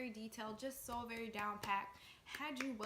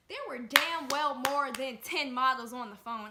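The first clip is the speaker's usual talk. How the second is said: louder, hammering the outrage; loud indignant outburst; 2 semitones higher, 14 dB louder than usual. Real, not acted. Telling friends about the scam, a loud indignant outburst. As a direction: belt out the disbelief at the numbers. Outrage at too many models.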